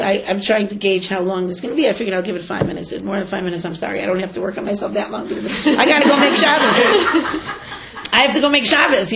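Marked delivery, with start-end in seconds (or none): 4.9-9.1 higher and louder, laughing through the words; chuckling at her own impatience. Real, not acted. Speaker jokes to listeners about needing to cook, laughing.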